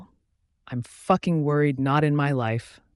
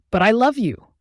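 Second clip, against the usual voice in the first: loud, higher, heartbroken cry; yelling; 6 semitones higher, 5 dB louder than usual. A narrator's reading. Anya yelling at her mate, heartbroken and loud. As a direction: throw the words at him. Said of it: "she yelled".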